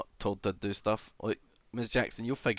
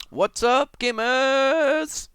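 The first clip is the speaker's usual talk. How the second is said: loud, much higher "gamers" call; hyped streamer greeting; 9 dB louder, much slower than usual. Pretend. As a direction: shout it like an over-the-top YouTuber.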